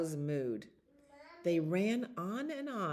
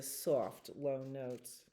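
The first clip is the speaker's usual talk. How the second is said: soft and low; 3 semitones lower, 7 dB quieter, much slower than usual. A narrator's reading. Narrator shows soft low notes.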